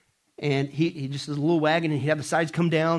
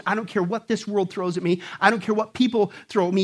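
ordinary talk, nothing marked

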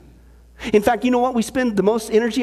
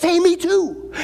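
excited; 8 semitones higher, slower than usual. Retold or imitated. Eager joke character, excited.

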